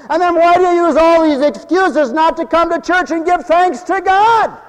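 fiery preacher's challenge; preaching at full force. Acted. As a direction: rise up and demand an answer.

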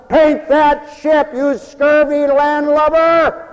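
loud, high, slow drawn-out order; acted ship's captain. Imagined captain ordering Tom to paint, hammed-up loud command. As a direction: bellow like a storybook sea captain.

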